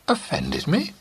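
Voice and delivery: mock-light high question; studied lightness. A narrator's reading. Companion deflecting Jim, forced light. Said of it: "in a voice of studied lightness".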